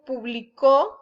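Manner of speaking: publicó stressed pu-bli-CÓ; pu-bli-CÓ, past-tense stress; stress on last syllable. Shown as a demonstration. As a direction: punch the final ó of publicó. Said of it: "es en pasado".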